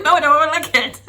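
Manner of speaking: laughing her way through a loud, high refusal; can't keep a straight face saying no. Retold or imitated.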